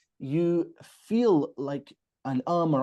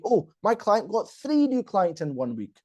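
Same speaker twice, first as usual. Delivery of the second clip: THREE punched in a raised pitch; emphasized key point; 5 semitones higher than usual. Shown as a demonstration. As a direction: hit "three new clients" high as the big news. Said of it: "you want to say".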